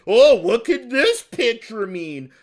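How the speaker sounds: mock wonder, high and loud; Keemstar. Mocking Keemstar's staged teaser.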